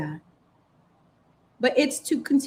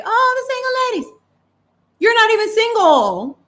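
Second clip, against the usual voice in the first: much higher, a sing-song mocking "Oh, the single ladies"; 12 semitones higher, 6 dB louder than usual. Mocking a singer's anthem to her audience, high and sarcastic. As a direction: send up a pop star's slogan.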